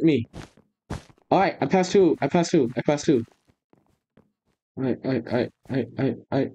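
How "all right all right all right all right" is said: ordinary talk, nothing marked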